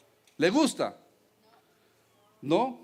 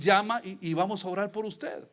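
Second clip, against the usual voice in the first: usual speaking voice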